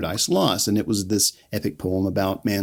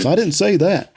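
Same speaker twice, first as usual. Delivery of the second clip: higher, lightly acted defensive reply; a hint of Kevin pushing back, pitched up; Kevin's denial; 7 semitones higher, 3 dB louder than usual. Kevin fending off Milton's question, lightly acted and higher. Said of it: "Milton and Kevin are feeling".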